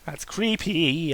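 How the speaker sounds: ordinary delivery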